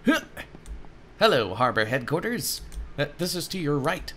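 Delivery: ordinary talk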